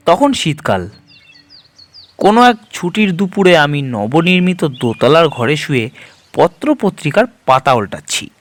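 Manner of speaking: ordinary delivery, nothing marked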